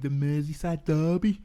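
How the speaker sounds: light, amateur Scouse imitation; putting on a bit of Scouse; attempted Scouse accent. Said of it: "my attempt at a Scouse accent".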